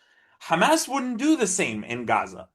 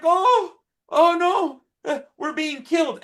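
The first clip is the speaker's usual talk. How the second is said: high, mocking victim voice; hammed-up mock distress; mock Hamas whine; 14 semitones higher than usual. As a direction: parody a fake victim crying out. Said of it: "Hamas is like".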